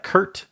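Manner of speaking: "Kurt" with an r-coloured vowel; Kurt, one syllable. Shown as a demonstration. Asking how a made-up word is said, "Kurt" rhyming with hurt. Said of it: "would you pronounce that".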